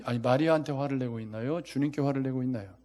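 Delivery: ordinary delivery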